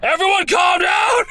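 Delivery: high, loud shout; loud call for order. Acted. Edgeworth shouts to calm everyone down.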